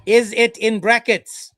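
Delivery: slow and loud, "Is it in brackets" spelled out; slow, deliberate. Real, not acted. Repeating for an opponent who won't answer, slow and insistent. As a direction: press each word of "Is it in brackets" slowly and loudly. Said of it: "I'm gonna say it slowly".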